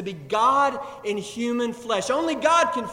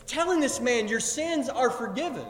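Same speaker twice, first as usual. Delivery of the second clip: acted-out incredulous challenge, pitched higher; higher-pitched, indignant questioning; objecting scribes; 4 semitones higher than usual. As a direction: object in disbelief, as an offended onlooker. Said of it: "they're standing off to the side and they're saying".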